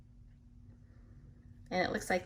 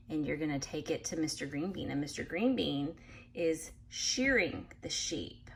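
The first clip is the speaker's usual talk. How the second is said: ordinary delivery, nothing marked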